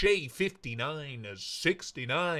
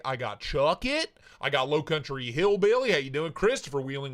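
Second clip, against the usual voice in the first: ordinary talk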